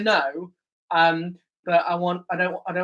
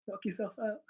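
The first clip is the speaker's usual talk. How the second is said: much softer muttered repeat of the punchline; muttered under breath; 19 dB quieter than usual. Real, not acted. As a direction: say it again to yourself, barely audible.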